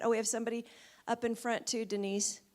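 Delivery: normal talking voice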